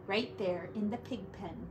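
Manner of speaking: ordinary delivery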